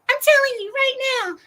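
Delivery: squeaky, fast mocking repeat; high mock hype. Shown as a demonstration. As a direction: jump way up in pitch and rush it, teasing.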